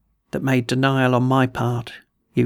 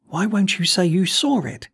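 higher-pitched, agitated plea; tearfully insistent; 5 semitones higher than usual. A narrator's reading. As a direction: press the question, close to tears. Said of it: "he repeated".

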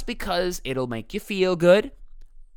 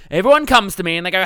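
ordinary talk, nothing marked

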